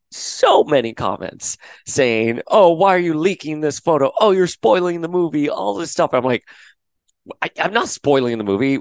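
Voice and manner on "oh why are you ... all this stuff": mocking whiny commenter.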